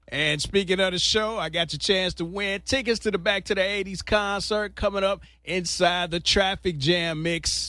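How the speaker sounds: punchy radio host sell; upbeat station promo read, hitting the key words. Acted. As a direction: announce a ticket giveaway to listeners.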